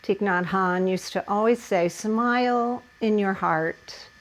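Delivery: hushed meditative teacher voice; soft guided relaxation. Acted. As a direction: ease the class into stillness. Settling class into hip stretch.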